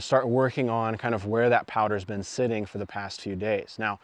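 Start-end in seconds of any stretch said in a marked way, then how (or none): none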